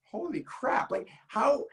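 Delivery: high-pitched retold astonishment; mildly acted incredulity, pitch jumping up; amazed disbelief. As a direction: blurt it out as if stunned. Retells own disbelief at being copied.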